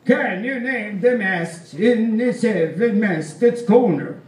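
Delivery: put-on stern old Swedish seaman testing a kid; stern old sailor. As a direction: quiz the boy like a gruff old Swede. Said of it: "He repeated the question".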